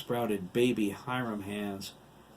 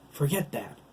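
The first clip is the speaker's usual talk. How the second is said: urgent; 5 semitones higher than usual. Acted. Urgent order during an attack.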